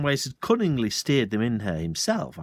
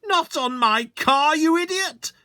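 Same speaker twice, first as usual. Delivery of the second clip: much higher, louder furious yell; bellowed; 5 dB louder than usual. Acted. Landowner furiously bellowing at the sergeant. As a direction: rage at the fool. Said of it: "Mister Hazel bellowed".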